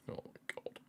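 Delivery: soft, voiceless whisper of dread. Real, not acted. Nervous fan whispering during endless stoppage time. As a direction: breathe it out under your breath.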